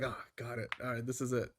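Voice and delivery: soft, low, mock-satisfied reply; suddenly quiet acceptance. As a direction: drop to a low, casual murmur, acting appeased. Acted acceptance after shouted demands.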